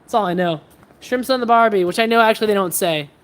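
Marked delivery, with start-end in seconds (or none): 1.0-1.8 loud, hammed-up Aussie catchphrase; mock Australian accent. Streamer teasing Australians with a catchphrase, mock Australian accent. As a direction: throw out a token Australian accent, louder. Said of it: "Is that Australian enough?".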